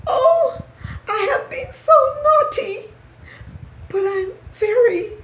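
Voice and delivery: high, loud tearful mouse wail; wailing, sorry little mouse; crying out. A narrator's reading. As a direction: squeak a sobbing apology. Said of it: "cried Christopher".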